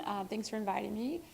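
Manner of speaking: usual speaking voice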